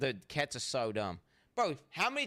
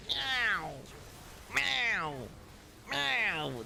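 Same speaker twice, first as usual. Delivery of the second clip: angry cat meow; 9 semitones higher, much slower than usual. Retold or imitated. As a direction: whine it like an annoyed cat left alone. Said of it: "angry meow where they go".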